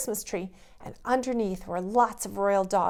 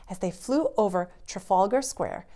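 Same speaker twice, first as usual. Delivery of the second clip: ordinary delivery, nothing marked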